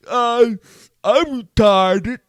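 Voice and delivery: slow, dragged mock confession; drawn-out mocking dim voice; lightly voiced dim-witted guy. Imitating a person admitting it.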